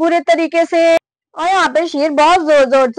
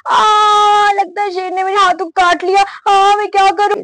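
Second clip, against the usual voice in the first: pained cry; 5 semitones higher than usual. Acted. Mother bitten on the hand by lion, pained wailing. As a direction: wail as if just bitten.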